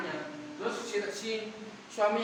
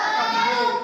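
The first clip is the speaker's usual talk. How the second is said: higher and louder, hitting the answer hard; emphatic answer; 14 semitones higher, 11 dB louder than usual. Shown as a demonstration. Teller cues children with an excited, loud answer. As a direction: cue the kids with a big loud answer. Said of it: "dia akan".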